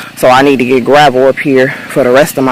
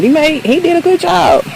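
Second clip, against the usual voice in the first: enthusiastic praise; 14 semitones higher than usual. Real, not acted. Praising the worker's job.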